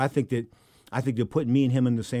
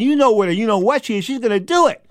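high, loud insistence; urgent warning; 10 semitones higher, 9 dB louder than usual. Retold or imitated. Host to fighter about the ex-wife, urgently insistent. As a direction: hammer home the threat, loudly.

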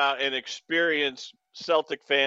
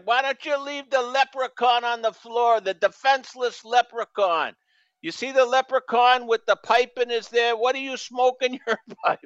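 high-pitched Boston heckler; Boston fan accent; 7 semitones higher than usual. Retold or imitated. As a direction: imitate a New England fan taunting a player. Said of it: "that accent".

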